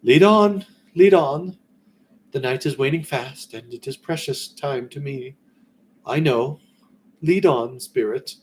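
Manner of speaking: put-on high, loud, urgent old Scrooge; Scrooge urging. Scrooge urges the phantom to lead on, high and urgent. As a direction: press the ghost onward, time running out.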